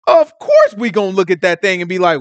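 usual speaking voice

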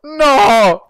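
very high, loud screams; screaming in panic. Real, not acted. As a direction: scream as you fall. Falls during parkour.

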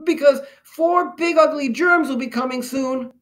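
very high-pitched little germ voice; Pox the earnest germ. A narrator's reading. Germ warns the immune army, high and urgent. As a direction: warn them in a high cartoon voice.

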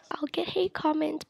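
unvoiced whispered aside; whispering. Real, not acted. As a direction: whisper it to the camera.

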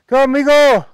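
calling out a greeting, high and loud. Real, not acted. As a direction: hail the workers from across the field. El conductor saluda a lo lejos. (llamando a lo lejos, agudo y fuerte)

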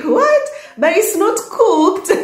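high, startled outcry of disbelief; shocked disbelief. Retold or imitated. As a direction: squeak it out like you can't believe the food is raw. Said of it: "I was like".

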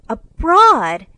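loud, high cry of amazement; excited cry. A narrator's reading. Jo hears the boy has been abroad, excited cry. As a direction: exclaim it, thrilled and astonished. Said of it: "cried Jo".

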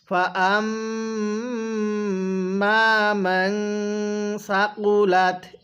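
very slow, drawn-out tilawat; slow recitation. Shown as a demonstration. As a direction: chant it slowly for students to repeat. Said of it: "আমার সাথে পড়ুন". (ধীর তিলাওয়াত)

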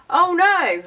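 loud, high mock dismay; mock audience cry. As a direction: cry it out like a whole crowd. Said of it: "I hear you all shout".

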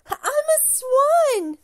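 thrilled, drawn-out exclamation; happy cry. A narrator's reading. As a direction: burst out with delight at the discovery. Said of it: "the ugly duckling cried happily".

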